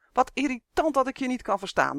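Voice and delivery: geërgerde, hogere elfenklacht; mopperend. Acted. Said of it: "mopperde hij". (elf Uf moppert tegen Justin, geërgerd)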